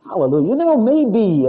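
retold elation. Retold moment of joy.

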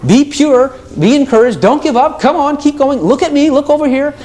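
high, coaxing encouragement; high eager coaxing voice; encouraging Jesus. Retold or imitated. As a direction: cheer them on like a coach. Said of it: "He's the one that encourages you".

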